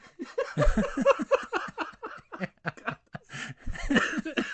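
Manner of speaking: long rising laugh; laughing hard. Real, not acted. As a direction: laugh until squeaking. Laughing at own joke.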